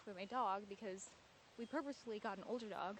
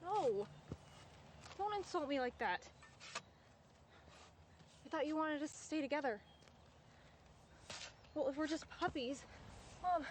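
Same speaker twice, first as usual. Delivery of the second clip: upset, raised voice, higher and louder; yelling; 6 semitones higher, 3 dB louder than usual. Acted. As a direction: yell in protest at mom on the phone. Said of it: "I'm sorry for yelling".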